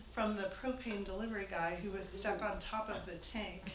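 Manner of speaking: ordinary talk, nothing marked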